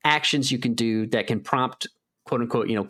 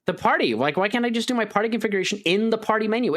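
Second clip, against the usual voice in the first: higher pitched, exasperated retelling of being fed up; mock outrage at the game menu, pitched up; retold frustration; 10 semitones higher than usual. Recounting to a friend being mad at the party menu, pitched-up exasperation. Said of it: "Like, I was so mad".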